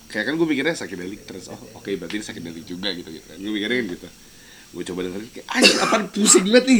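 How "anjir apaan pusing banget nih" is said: exasperated. Retold or imitated. Retelling own reaction to trance.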